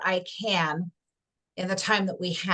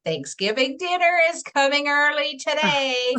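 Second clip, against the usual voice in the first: gleeful cat; 5 semitones higher, 4 dB louder than usual. Acted. Cat eyeing the mice hungrily.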